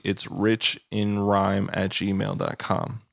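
slow, careful spelling out. Shown as a demonstration. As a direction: say it slowly and clearly. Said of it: "I always thought my email was easy".

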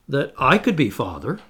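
usual speaking voice

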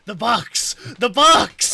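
shouted demand. Real, not acted. Friend calls for the mystery box, shouting it. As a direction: shout it out like a chant.

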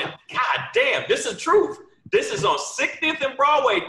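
loud, high amazed exclamation; exclaiming in disbelief. Retold or imitated. Comic telling the hosts about the street heckler, loud. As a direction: throw up your hands, it's really happening. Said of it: "that's when I went".

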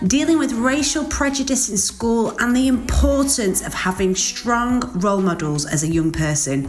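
bright, upbeat host intro over music; podcast intro read. Acted. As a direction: welcome listeners like a radio host.

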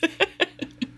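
high light laugh; a quick real laugh. Real, not acted. Laughing after joke.

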